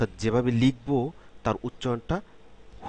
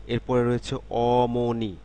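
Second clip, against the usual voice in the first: ordinary talk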